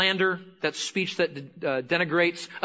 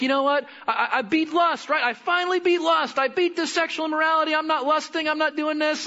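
proud boasting; 10 semitones higher than usual. Retold or imitated. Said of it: "You're like".

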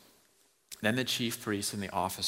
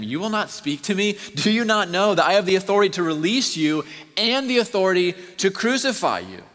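loud, much higher, driving sermon voice; preaching at full force; 7 semitones higher, 10 dB louder than usual. Acted. Preacher thundering the trial scene at the congregation, loud and high. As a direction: pound it out to the back row.

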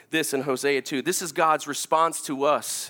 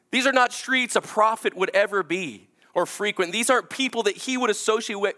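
preaching; 8 semitones higher, 4 dB louder than usual. Acted. Preacher retelling Hosea's search.

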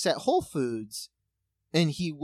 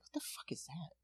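whispered to herself in disbelief; a stunned, hushed what-the; shocked whisper; 18 dB quieter than usual. Retold or imitated. Shocked aside at strange bags.

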